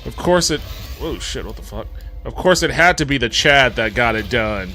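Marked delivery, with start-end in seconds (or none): none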